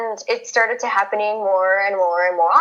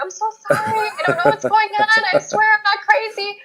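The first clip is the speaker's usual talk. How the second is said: much higher, laughing apologetic voice; pleading sorry, pitched way up; apologetic squeak; 12 semitones higher than usual. Retold or imitated. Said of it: "I kept apologizing".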